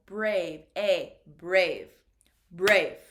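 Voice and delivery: brave said loud with a long clear A; teacher modelling brave loudly; emphatic model word. Shown as a demonstration. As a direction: belt out brave for the class, stretching the A. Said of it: "You pronounce that A sound".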